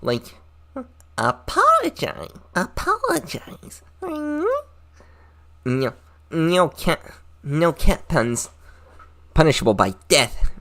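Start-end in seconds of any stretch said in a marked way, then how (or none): 1.2-10.5 very high, slow demanding voice; mock anime character. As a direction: ham up a shrill anime girl.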